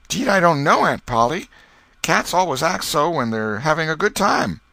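a boy playing dumb to his aunt; Tom, eager and guileless; innocent boy voice. Tom excuses himself to aunt.

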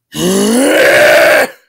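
loud, rising frustrated scream; rage scream. Real, not acted. Gamer at the end of their rope, loud rising scream. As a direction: let out a long scream that climbs.

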